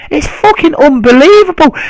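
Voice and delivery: high-pitched real exasperation; exasperated rant. Real, not acted. Fan ranting about a rival's insults, exasperated. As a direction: let disbelief push your voice right up.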